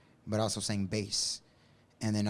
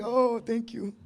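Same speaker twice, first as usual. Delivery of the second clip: high mock thanks; 11 semitones higher, 3 dB louder, slower than usual. Mock thanks after singing.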